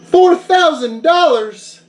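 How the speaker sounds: high and slow, aghast; stunned disbelief. Acted. Caller repeats the price back to Yoshi in slow disbelief. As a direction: drag out the shock at the price.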